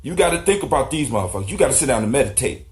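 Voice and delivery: usual speaking voice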